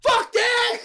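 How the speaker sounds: high, loud outburst; mock defiance. Answering a friend's "they gotta eat", defiant mock shout. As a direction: shout down the objection with comic indignation. Comic refusal to feed them.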